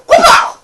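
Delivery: squealed, very high, very loud whoop; cartoon whoop. Spotify showing off as it arrives, giddy shrieking whoop.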